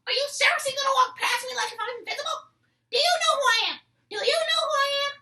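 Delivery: very high, loud indignant mock teacher; teen imitating the teacher scolding him, high and loud; teacher screaming. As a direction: demand it like an offended teacher. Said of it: "she keeps on screaming at me".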